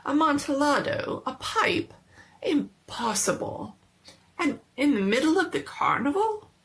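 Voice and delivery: lightly voiced tipsy man, startled and keen; excited disbelief. Fortunato's excited disbelief over wine.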